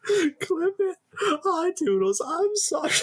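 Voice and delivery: squeaky parody greeting; mock high voice. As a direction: tease him in a high cartoon voice. Mimicking friend's greeting.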